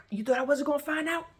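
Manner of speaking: rising rage. Retold or imitated. Swinging the belt at him, rising rage. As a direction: pitch it up, building toward a yell.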